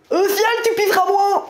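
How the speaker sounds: high, loud shouted jeer; mock heckler yell. Mock heckling comment voiced, yelled.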